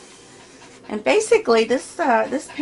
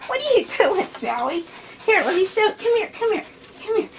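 dog talk; 13 semitones higher than usual. Real, not acted. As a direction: coax the little dog over in a much higher voice. Talking to her dog Sally.

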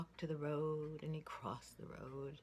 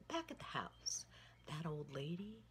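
ordinary delivery

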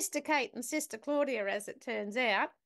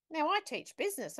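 normal talking voice

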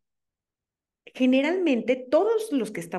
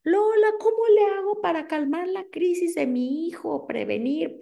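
worried parent asking; 10 semitones higher than usual. Retold or imitated. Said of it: "cuando me preguntan".

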